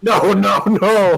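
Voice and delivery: loud, high repeated refusal; dismayed protest. Real, not acted. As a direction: cry out in comic horror. Comic horror at Jared in chat.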